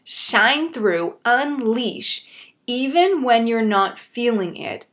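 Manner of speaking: slow, phrase-by-phrase emphasis with pauses; deliberate repeat. Real, not acted. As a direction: say it slowly, pausing after each phrase. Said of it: "I'm gonna repeat that".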